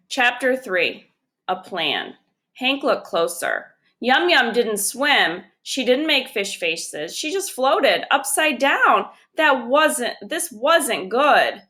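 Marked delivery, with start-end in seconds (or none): none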